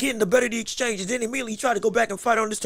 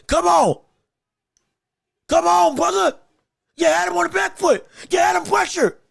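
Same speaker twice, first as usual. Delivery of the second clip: loud, high yelling at the screen; frustrated shouting; 5 semitones higher, 3 dB louder than usual. Real, not acted. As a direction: yell at him to press forward. Shouting at struggling fighter.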